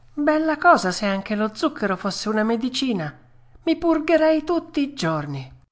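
high-pitched delighted puppet; Pinocchio, pleased. Acted. Puppet savouring the sugar, high and pleased. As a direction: voice a greedy puppet, pitched well up.

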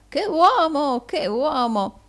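loud, high-pitched admiring cry; gushing, hammed-up wonder; gurgling exclamation. A narrator's reading. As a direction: gurgle it out in an old usurer's voice. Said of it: "di nuovo gorgogliò e di nuovo esclamò".

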